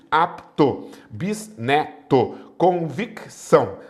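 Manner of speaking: "Apto, bisneto, convicção" slowly broken into syllables; split consonant clusters. Shown as a demonstration. Teacher dividing internal consonant clusters, syllable by syllable. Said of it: "Separam-se os encontros consonantais".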